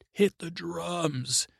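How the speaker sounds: high, drawn-out mock call; called-out order. Davos to his boats, calling out an order. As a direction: call it out long and high, like a ship's captain.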